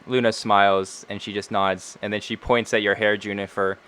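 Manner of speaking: ordinary talk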